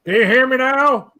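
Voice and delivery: high, slow ad catchphrase; Verizon commercial voice. Retold or imitated. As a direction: mimic the phone ad guy. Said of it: "It's in a Verizon commercial".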